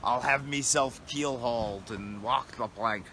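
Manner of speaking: slow, hammed-up old pirate; apologetic mock pirate. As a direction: ham it up as a tired old sea dog. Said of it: "I'm meant to be talking like a pirate".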